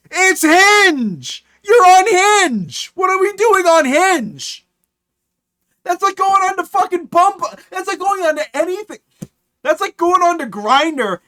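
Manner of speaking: loud, high-pitched incredulous yelling; exasperated rant. Real, not acted. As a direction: yell it in sheer disbelief. Ranting at the message writer.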